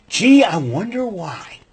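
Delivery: slow, dry sarcasm; slow sarcastic aside. Real, not acted. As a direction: drag out "I wonder why" mockingly.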